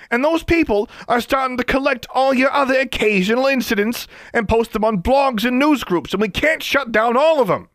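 high, loud ranting yell throughout; furious rant. Acted. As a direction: rage at him without pause. Johnson ranting at governor.

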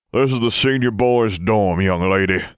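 high, loud, haughty wizard voice; sniffy old wizard. Acted. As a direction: sniff it down your nose. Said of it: "sniffed a pinch-nosed wizard".

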